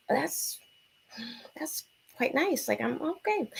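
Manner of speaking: soft, high, slow murmur; hushed, halting and impressed; coy approval. Retold or imitated. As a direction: act out being pleasantly taken aback. Coy reaction to advance, retold.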